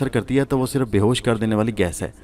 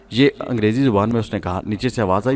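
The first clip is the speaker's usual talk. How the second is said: plain reading voice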